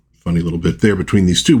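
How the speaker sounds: normal talking voice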